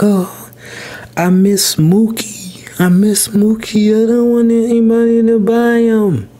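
put-on high, teary child's voice, words stretched out; imitating crying son. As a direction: wail it like a heartbroken little boy. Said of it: "So he was crying over the Shih Tzu".

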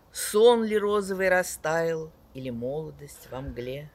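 loud, sweeping poetry recital with big swells; dramatic poetry declamation. Acted. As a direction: declaim each line like on stage. Reads poems aloud to audience.

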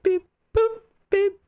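high mock hospital monitor beeping; heart monitor beeps. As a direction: beep like a hospital machine. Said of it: "the machine at the hospital, we'd be like".

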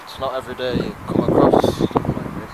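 usual speaking voice

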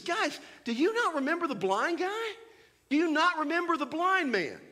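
a preacher voicing Jesus, exasperated with the crowd. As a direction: urge them to remember.

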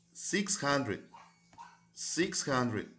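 "six hundred" said twice, clearly, stress on "hundred" then "Six"; model pronunciation. Shown as a demonstration. Teacher showing students a number, clear model. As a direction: show how the number sounds. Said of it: "The pronunciation of this number is".

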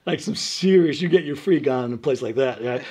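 ordinary talk

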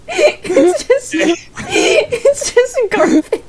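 high, tearful-sounding laughing squeal; helpless laughter. Real, not acted. Streamer to friend who tried his best, helpless laughter. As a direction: stretch the words out, dissolving into laughter.

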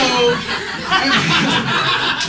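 long, high laughter. Real, not acted. Finishing the witch story, long laughter. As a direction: laugh through the punchline.

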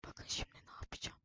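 secret whisper to viewers. Real, not acted. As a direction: whisper the plan. Izleyicilere fısıldıyor. (fısıltıyla)

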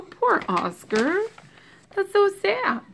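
high, soft mock-sad sympathy; cooing pity. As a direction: coo over the poor little dog. Reader pities Oscar for child.

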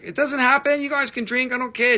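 high, loud mock drunk promise; mock drunk customer. Imitating drunk buyers promising they'll pay, high and loud. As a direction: ham up a tipsy buyer's excuse. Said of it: "And they're like".

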